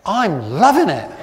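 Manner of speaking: loud, laughing mock delight; laughing irony. Pastor joking about England thrashing Wales, laughing sarcasm. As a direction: fake cheer through a laugh. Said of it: "I'm going".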